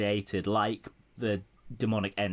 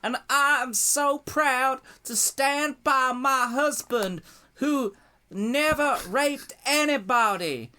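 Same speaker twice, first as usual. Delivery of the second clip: high, slow, hammed-up Southern drawl; Hillary Clinton's Southern accent; 4 dB louder, slower than usual. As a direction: put on an exaggerated Southern US accent, pitched way up. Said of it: "she'll kind of return to how I assume she spoke on the campaign trail".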